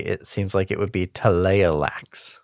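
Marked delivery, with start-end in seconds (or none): 1.1-2.4 Taleolax sounded out syllable by syllable; phonetic pronunciation. Shown as a demonstration. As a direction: say Taleolax as spelt, with separate vowels. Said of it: "looking at it phonetically".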